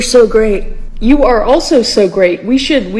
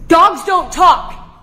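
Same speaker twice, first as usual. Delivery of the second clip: indignant shout; 8 semitones higher, much slower than usual. Acted. Arguing that dogs can't talk.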